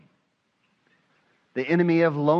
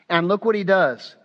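ordinary delivery

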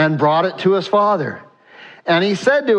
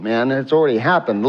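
normal talking voice

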